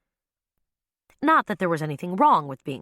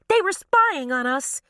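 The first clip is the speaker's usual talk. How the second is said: high, shrill accusation; 10 semitones higher, 4 dB louder than usual. A narrator's reading. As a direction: shriek it out high and slow. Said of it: "shrilled a woman".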